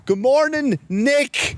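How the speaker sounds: high sing-song greeting called across the room; mock yelled greeting. Gym guy calls across the gym to Mick, high mock yell. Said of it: "he yells".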